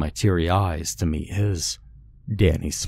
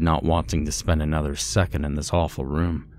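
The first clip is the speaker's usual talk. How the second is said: ordinary delivery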